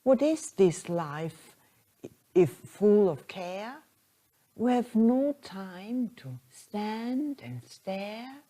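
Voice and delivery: slow, measured verse reading; poem recitation. Acted. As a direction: recite the poem line by line, unhurried.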